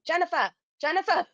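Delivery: sing-song grandma call, lightly done; imitating grandma. Grandmother calling her granddaughter to the party, imitated high. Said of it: "I just love how she talked".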